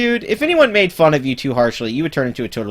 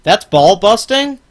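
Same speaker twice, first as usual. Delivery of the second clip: mock disbelief; 9 semitones higher, 6 dB louder, slower than usual. Mocking a guest's claim.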